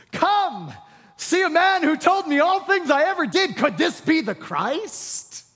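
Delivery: calling out. Retold or imitated. Woman calling to the city.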